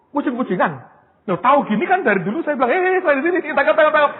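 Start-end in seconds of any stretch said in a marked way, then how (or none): 2.7-4.1 higher, louder call to be caught; playful shout of surrender; mock calling out. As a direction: wave them over, shouting. Calling out to police in dream.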